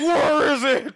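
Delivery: high, laughing Batman-style demand; laughing mock interrogator. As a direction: bark it while laughing. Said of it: "I was like".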